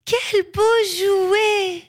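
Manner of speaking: put-on high, drawn-out little girl voice; high-pitched childlike wonder; delighted giant girl. A narrator's reading. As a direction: marvel slowly in a high child's voice. Giant girl delighted by her find.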